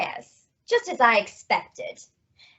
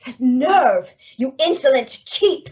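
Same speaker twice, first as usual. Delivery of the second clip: louder, offended snap at an insult; indignant outburst; 4 semitones higher, 4 dB louder than usual. Acted. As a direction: bristle with outrage at the messenger. Insulted woman snaps back.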